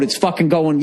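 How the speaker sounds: usual speaking voice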